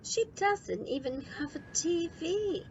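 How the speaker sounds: put-on the boy protests there is no TV; indignant child complaint. A narrator's reading.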